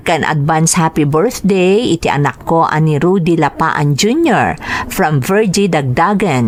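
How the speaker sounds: ordinary talk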